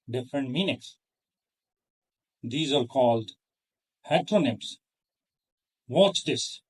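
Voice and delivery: ordinary talk, nothing marked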